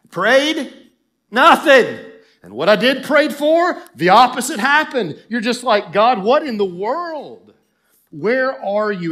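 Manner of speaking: loud, high, exasperated outburst; preacher acting out exasperated prayer; frustrated cry to God. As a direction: throw up an indignant protest at heaven.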